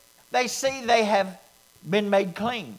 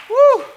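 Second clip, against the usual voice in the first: high, loud woo of praise; joyful shout; 14 semitones higher, 11 dB louder than usual. Real, not acted. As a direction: whoop with joy.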